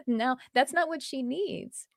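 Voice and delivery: ordinary talk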